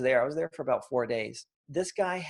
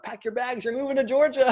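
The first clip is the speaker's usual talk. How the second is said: excited news; 11 semitones higher, 7 dB louder than usual. Retold or imitated. Calling wife after first check.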